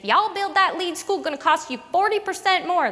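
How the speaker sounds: high, loud Texas twang; a hammed-up Texas accent. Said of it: "That's a terrible ter-, Texas accent".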